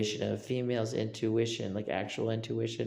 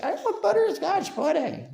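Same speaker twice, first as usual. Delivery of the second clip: put-on much higher, louder childish plea for butterscotch; mock whiny craving; 8 dB louder than usual. Imitating someone craving pudding, high whiny plea. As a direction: squeal it like a kid begging for a treat. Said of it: "But sometimes you're just like".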